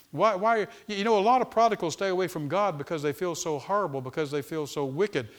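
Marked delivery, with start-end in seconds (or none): none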